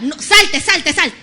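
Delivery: loud, firm command to get out; commanding shout. Retold or imitated. Preacher voicing God ordering the sinner out, loud.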